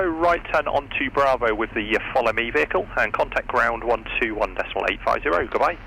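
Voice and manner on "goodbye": ordinary talk, nothing marked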